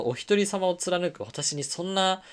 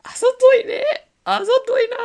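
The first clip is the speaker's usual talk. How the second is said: much higher, louder cry of あざとい; squealing in disbelief; amazed exclamation; 10 dB louder than usual. Real, not acted. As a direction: blurt it out, half shocked, half delighted. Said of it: "めちゃくちゃあざといなと思ったの".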